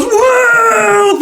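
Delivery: high, drawn-out "woo"; long shrieked cheer; crowd cheer. Acted. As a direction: whoop like a rowdy party crowd.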